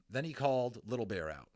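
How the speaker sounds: ordinary delivery, nothing marked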